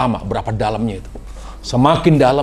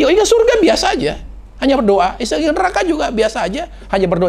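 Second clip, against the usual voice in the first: high, earnest Utsman voice; Utsman voiced; 13 semitones higher than usual. Preacher voicing Utsman explaining his tears, raised earnest voice. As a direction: lift the voice high, explaining calmly.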